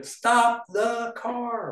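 very high, drawn-out mock yell; all-caps exclamation. Shown as a demonstration. Editor exaggerating for a joke, all-caps mock yell. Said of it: "all caps, three exclamation".

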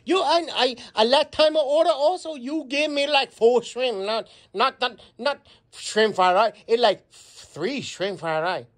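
complaining customer in a mock Chinese accent. Customer complaining about small shrimp, in a Chinese accent. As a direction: grumble through a put-on Chinese accent. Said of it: "we're gonna do a Chinese accent".

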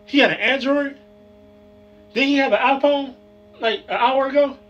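high shocked disbelief. Acted. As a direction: voice jumps up in shock. Shocked realization of scam.

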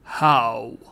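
low, hollow one-word demand; solemn bell goblin. A narrator's reading. Goblin bell demands proof from Trotty, solemn and low. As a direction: demand it in a deep spectral voice.

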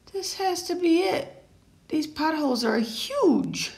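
put-on high childlike character voices; high excited kid voices in a story. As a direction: play the kids and sign kids eagerly. Signs regret leaving.

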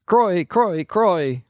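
three quick alarm calls; short urgent calls. A narrator's reading. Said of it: "three short blasts".

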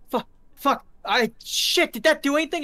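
high loud flustered babble; panicked thug. Acted. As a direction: freak out as the bullets bounce. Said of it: "he's like".

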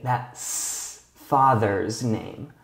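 "father's" stretched out slowly with a clear S; very slow, stressed. Shown as a demonstration. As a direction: say "That's father's name" very slowly, leaning on "father's". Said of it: "add an S and an apostrophe".

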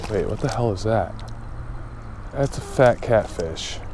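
ordinary talk

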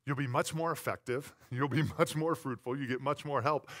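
ordinary delivery

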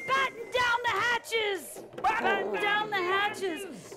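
shouted order. Acted. Captain ordering crew in the storm, shouted order. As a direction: call it over the wind.

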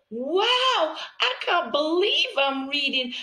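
put-on high, loud kid voice full of wonder; amazed child. Child amazed at reading for the first time. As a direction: gasp it like a thrilled new reader. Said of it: "So they say".

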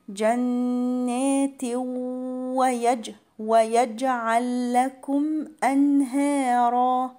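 "جنات" chanted very slowly, hummed into "ويجعل"; merging with ghunna. Shown as a demonstration. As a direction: recite slowly, holding the nasal link after "جنات", clear n in "أنهارا". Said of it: "هيبقى فيها إدغام بغنة". ("جنات ويجعل" بإدغام وغنة)

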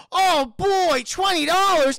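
high, loud fake excitement; hammed-up bad acting. Imitating the pizza delivery man getting twenty dollars, hammed-up bad acting. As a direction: shout it like a terrible actor. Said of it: "he is the worst actor imaginable".

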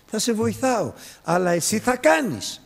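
high, loud and firm, as God replying. Retold or imitated. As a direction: proclaim it from above, insisting.

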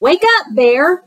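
high, loud wake-up call to a sleeping friend; cried out. Acted. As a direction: rouse him with a bright high cry. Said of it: "cried Fox".

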